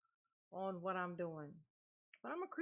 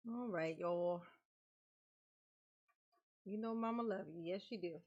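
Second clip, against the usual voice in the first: normal talking voice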